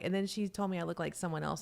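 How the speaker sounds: normal talking voice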